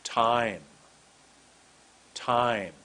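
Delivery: Time with its vowel stretched out, falling; Time, slow and deliberate, one word alone; long vowel. Shown as a demonstration. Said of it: "Make sure you have a long vowel".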